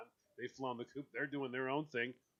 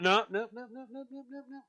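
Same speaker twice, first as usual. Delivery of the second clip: higher, hurried no's; refusing food; 7 semitones higher, 4 dB louder than usual. Retold or imitated. Guest refusing more corn from the hostess, higher hurried no's. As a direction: wave off the corn. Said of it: "I'll say".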